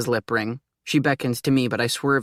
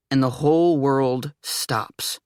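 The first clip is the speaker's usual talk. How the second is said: ordinary delivery, nothing marked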